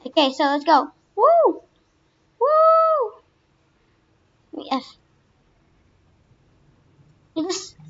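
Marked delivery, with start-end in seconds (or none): 1.2-3.1 very high cheering squeal; excited whoop. Real, not acted. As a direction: squeal down the slide. Whooping down slide.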